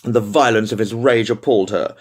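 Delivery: ordinary delivery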